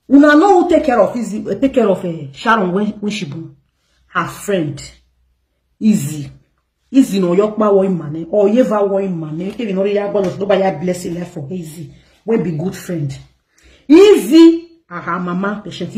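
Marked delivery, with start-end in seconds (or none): 0.1-1.5 higher, louder pointed question to the viewers; challenging question. Real, not acted. Vlogger challenging her viewers, pointed, higher and louder. 13.9-14.8 Izzy cried out much higher and louder; emphatic exclamation. Real, not acted. Vlogger revealing who cared for Sharon, exclaims loudly.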